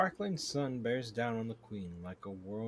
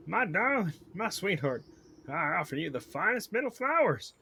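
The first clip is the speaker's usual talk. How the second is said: higher, louder southern suitor; weird southern accent; 9 semitones higher, 9 dB louder than usual. Acted. Beekeeper offers his sweetheart flowers, weird southern accent. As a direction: court the queen in an odd southern accent. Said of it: "like, a weird southern accent".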